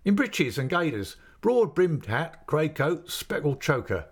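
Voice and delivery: put-on a friendly innkeeper's man coaxing the boy; chatty waiter. A narrator's reading. Waiter at the inn teasing the boy about the ale, lightly voiced. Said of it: "said the waiter".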